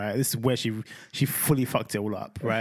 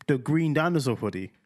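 usual speaking voice

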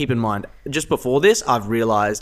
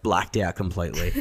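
normal talking voice

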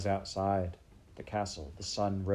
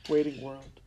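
ordinary delivery, nothing marked

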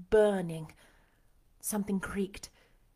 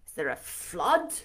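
low-pitched startled question; cried out; 4 semitones lower than usual. A narrator's reading. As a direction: ask low, in startled disbelief. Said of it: "he cried".

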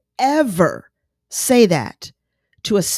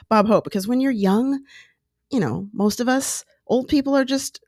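ordinary talk

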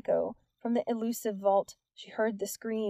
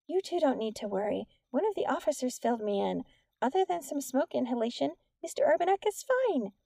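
higher-pitched, lilting teacher voice; kindly teacher, reassuring; 4 semitones higher than usual. A narrator's reading. Teacher greeting two pupils after the fire, reassuring. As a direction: reassure them in a raised, swooping voice.

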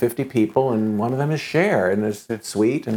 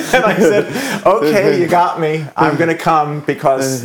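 higher, louder through laughter; laughing; 6 semitones higher, 7 dB louder, slower than usual. Real, not acted. Answering [name]'s joke, laughing. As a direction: giggle out the surrender.

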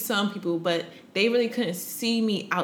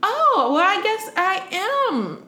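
retold realization; 12 semitones higher, 4 dB louder, slower than usual. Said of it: "And I was like".

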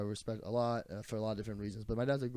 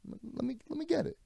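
dad insisting; 3 dB quieter than usual. Retold or imitated. Dad insisting again, high.

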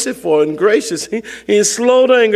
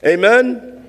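preaching; 4 semitones higher than usual. Acted. Pastor rallying the church to praise, exhorting. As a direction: lift the room toward praise.

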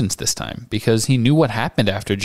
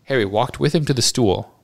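plain reading voice

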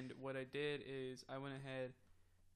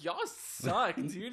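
loud, higher mock reproach; indignant retold outburst; retold exasperation; 4 semitones higher, 9 dB louder, much slower than usual. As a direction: call out your friends for the prank. Retold reproach to prankster friends.

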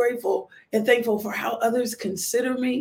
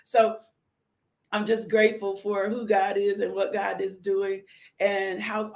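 ordinary talk, nothing marked

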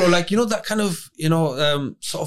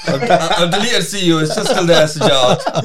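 loud laughing protest; 6 semitones higher, 8 dB louder than usual. Real, not acted. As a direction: laugh it out loudly. Laughing defence of email.

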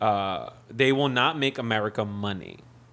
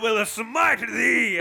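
slow, high, shouted smiting; mock biblical prophet; 10 semitones higher, much slower than usual. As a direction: thunder it like a hammy prophet. Prophet bit continues.

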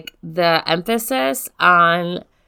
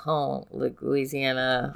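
mock French accent; 5 semitones lower, 10 dB quieter, slower than usual.

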